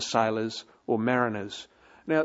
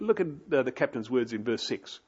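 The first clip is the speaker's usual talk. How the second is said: usual speaking voice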